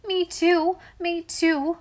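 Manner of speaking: high, loud eager clamor. A narrator's reading. Two children begging the girl for a kiss, clamoring. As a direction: pipe up eagerly, wanting a turn. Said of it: "clamored Dick and Dolly".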